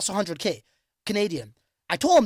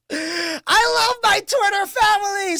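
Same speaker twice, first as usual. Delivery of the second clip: gushing; 10 semitones higher, 4 dB louder, slower than usual. Real, not acted. Streamer gushing to his Twitter followers, high and slow. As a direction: sing it out high and slow, full of love.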